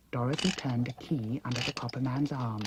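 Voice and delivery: plain reading voice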